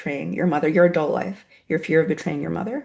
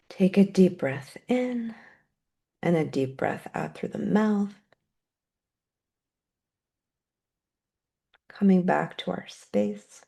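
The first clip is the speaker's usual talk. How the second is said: guided meditation. Acted. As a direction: guide the room gently into gratitude. Breathing cue ending the meditation.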